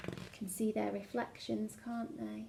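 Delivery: soft, quick hushed asking; quiet aside to children. Acted. Storyteller pausing to ask listening children, soft and quick. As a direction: lean in and ask the kids softly.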